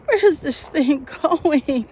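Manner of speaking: high, loud, slow fearful question; anxious. Real, not acted. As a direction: fear climbing. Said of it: "I'm starting to hyperventilate".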